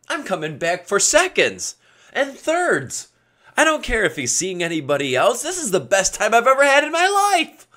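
high-pitched delight building to a loud squeal; mock thrilled. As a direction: build from surprise to ecstatic mock outburst. Imagined girls thrilled by offer.

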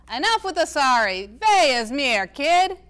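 exasperated grandpa. A narrator's reading. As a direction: throw up your hands at her. Grandpa Ace voiced in reading.

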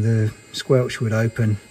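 ordinary talk, nothing marked

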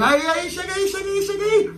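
high mock call across the road; calling colleagues over. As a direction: wave the others over, grinning.

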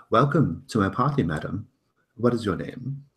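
lightly voiced host, brighter pitch; singsong welcome. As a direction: greet her with polite flourish. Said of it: "he says".

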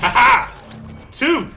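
high, loud gleeful shout; triumphant shout. Real, not acted. Gamer celebrating a second kill, triumphant shout. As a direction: crow over the kill.

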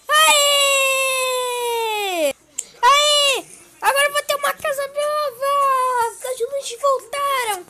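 high, loud cheering with a tearful edge; girl cheering and crying with joy; joyful cheer. Acted. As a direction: cheer it like happy tears. Girl cheers over new house.

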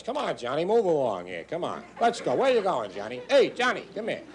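New York Irish cop. Retold or imitated. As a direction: move them along like an Irish beat cop. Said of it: "third generation Irish voice".

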